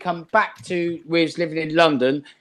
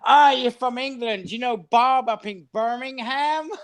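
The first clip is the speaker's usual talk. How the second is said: high, slow, drawn-out mock American accent; eager American tourist impression, pitched up; American guy; 7 semitones higher than usual. Comedian's American asking an Englishman about Birmingham, mock American accent. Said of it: "the American guy going".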